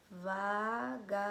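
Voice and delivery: syllable-by-syllable demo. Shown as a demonstration. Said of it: "qual que é a sílaba mais forte".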